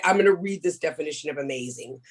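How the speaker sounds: ordinary talk, nothing marked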